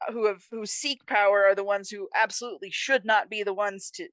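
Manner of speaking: usual speaking voice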